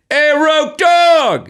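high, loud, slow call across the street; cheerful greeting called out across the street. Retold or imitated. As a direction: shout it out to him from across the road. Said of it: "and I go".

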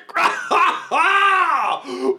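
high, long theatrical guffaw; uproarious blowhard laugh. Acted. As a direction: roar with laughter in character. Blowhard roars at the joke.

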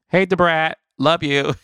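high, bright greeting with a laugh; excited shout-out. Real, not acted. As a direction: call out to a friend, delighted. Shouting out a friend.

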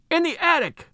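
very high, restrained mock scream; high-pitched frantic exclamation; acted scream. A narrator's reading. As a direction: cry out in panic, pitch leaping, no extra volume. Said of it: "she screamed".

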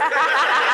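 laugh. Real, not acted. Laughing at own story.

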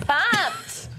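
high squeaky mock hype; hyped up. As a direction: squeal it with fake excitement. Hyped repetition game.

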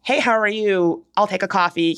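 ordinary talk, nothing marked

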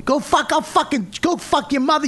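high-pitched, ranting blow-up; fly off the handle; explosive tirade. Retold or imitated. Acting out his explosive reaction.

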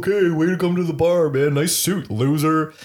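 put-on loud, high sneering teenage taunt; mocking taunt. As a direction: jeer at the older guy. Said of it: "it'd be like".

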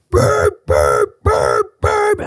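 imitating a blaring alarm, pitch jumping; frantic alarm beeps. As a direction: mimic the urgent house alarm. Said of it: "I'm talking".

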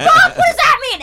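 high, loud panicked outburst; hammed-up terror of a cornered kid; mock panic. Child dreading grandpa's next move, in mock panic.

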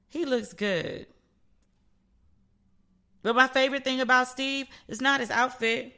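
ordinary talk, nothing marked

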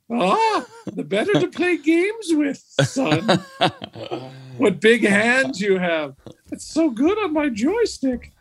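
very high, laughing granny voice; hammed-up wolf in grandma disguise. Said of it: "Big Bad Wolf".

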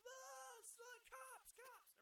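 very high, drawn-out mock scream; panicked mock yell. Kids scattering from an adult at the fight, high mock yelling. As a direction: squeal the warning in mock panic. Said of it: "Everybody just starts yelling".

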